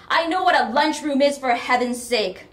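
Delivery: high, loud retort; exasperated mother. A narrator's reading. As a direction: snap back at full voice. Mother retorts angrily on phone.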